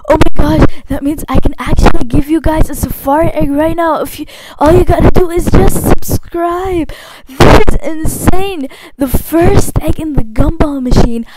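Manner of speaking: loud, thrilled squealing; excited. Real, not acted. YouTuber announcing a giveaway to fans, loud and thrilled. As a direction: gush at the top of your voice.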